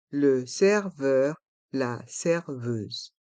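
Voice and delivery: "Le serveur, la serveuse" syllable by syllable, slowly; slow model pronunciation. Shown as a demonstration. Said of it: "Prononçons".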